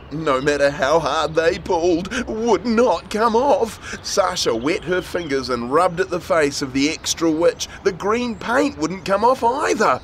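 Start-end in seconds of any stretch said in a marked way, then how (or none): none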